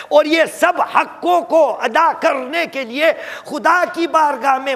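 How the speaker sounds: high, loud, rhythmic sermon list; fervent pulpit litany; preaching at full force. Acted. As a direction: hammer each line to the listeners. Preacher lists rights in a crescendo.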